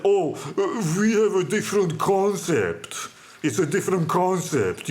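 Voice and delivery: affected, precious shop-clerk voice; mocking put-on snooty affectation; self-important and precious. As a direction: mimic a pretentious clerk savoring the words. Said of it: "very self-important and precious".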